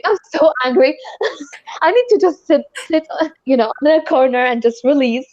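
exasperated mock rant, breaking into a laugh; mock angry rant. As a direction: play at being fed up with yourself, laughing. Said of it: "you're so angry and you're like".

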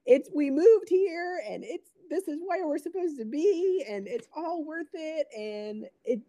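high-pitched mock blubbering; imitated bawling. Bawling wife explains herself to confused husband, imitated high weepy voice. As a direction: imitate gushing through tears, voice way up high. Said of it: "I'm, like, bawling".

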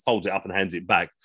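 plain reading voice

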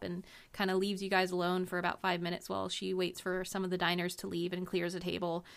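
normal talking voice

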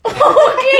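high, loud burst of laughter; laughing. Real, not acted. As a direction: laugh out the words. Laughing at "bom dia.

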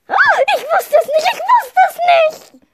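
high, loud laughing character voice; squeaky cat voice. Acted. Character voice in told story.

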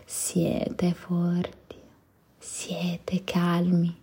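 very slow, fading whispered affirmations; slow soothing whisper. Acted. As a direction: let each phrase settle, drawn out. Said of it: "closing affirmations slowed right down".

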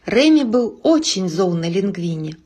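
plain reading voice

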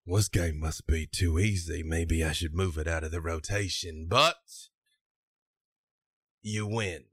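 put-on scoundrel voice, wry; scoundrel game runner. Scoundrel concedes the ring game to the winner, wry.

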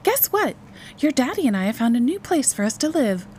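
put-on high-pitched, excited announcement to a child; infused enthusiasm. A narrator's reading. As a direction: sell the news with big enthusiasm. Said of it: "infusing her voice with enthusiasm again".

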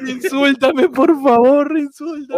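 high-pitched hammed-up begging; playful wail for mercy; mock pleading.

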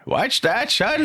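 put-on high, sweet old lady voice; grandma voice. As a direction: play a kindly grandmother. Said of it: "grandma voice".

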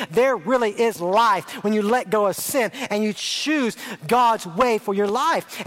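ordinary talk

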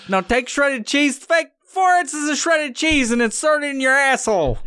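high, squealing parody chef; mock cookbook voice. Joke cookbook reading out a recipe to the reader, mock cooking-show squeal.

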